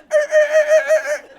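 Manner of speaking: high loud yipping dog noises; excited dog whine. Retold or imitated. Owner imitating dog chasing rabbit, high yipping whine. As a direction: mimic a dog straining after prey.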